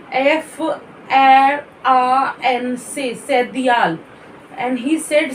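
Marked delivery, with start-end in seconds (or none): 0.1-4.0 F R A N C spelt slowly, letter by letter; spelling out. Shown as a demonstration. Teacher showing how he spelled Fran, slow spelling out. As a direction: draw out F R A N C as French letter names, then Sedial. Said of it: "Then he said the spelling of Fran".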